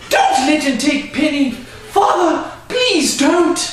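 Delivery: put-on high, pleading girl's voice. Girl begging father not to let the horse go, pleading. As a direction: beg her father desperately.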